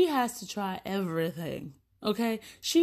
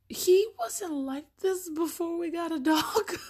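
high, slow, laughing through words; words breaking into laughter; 8 semitones higher than usual. Real, not acted. Laughing about son's change.

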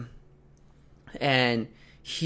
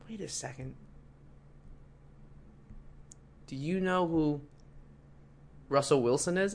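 dawning realisation; 3 semitones higher, 11 dB quieter than usual. Retold or imitated. Desk guy realises who the player is, quietly amazed.

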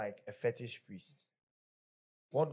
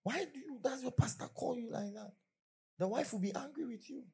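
put-on high, strange scolding voice of an older woman; high reproachful mother; 13 semitones higher than usual. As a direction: warn him like a disapproving mother. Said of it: "the mother of the person said".

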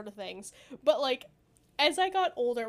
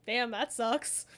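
normal talking voice

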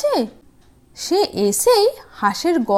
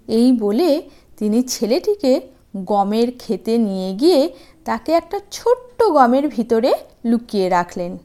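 ordinary delivery

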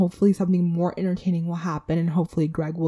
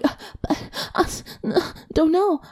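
mumbled, breathy moaning reply, high; reenacting Cody; 10 semitones higher than usual. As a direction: fumble the denial like a caught bachelor. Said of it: "reenact Cody".

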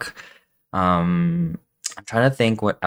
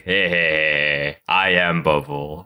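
Bubble voice; 5 semitones lower, slower than usual. Pretend. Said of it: "be like".

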